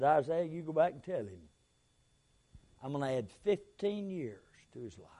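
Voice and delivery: whispered low, unvoiced divine promise; soft whisper of God promising years; hushed voice of God. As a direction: breathe God's words like a secret.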